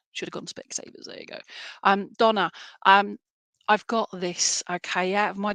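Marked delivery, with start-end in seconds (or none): 0.1-1.4 whispered self-mocking aside; very soft, throwaway whisper; muttered aside. Real, not acted. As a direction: whisper it under your breath. Muttered joke about misreading a name.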